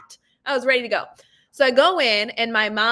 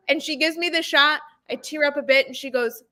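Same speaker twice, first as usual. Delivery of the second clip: usual speaking voice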